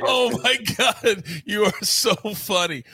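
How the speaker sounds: slow, breathy, recovering from laughter; words pushed out between laughs; after laughing hard. Real, not acted.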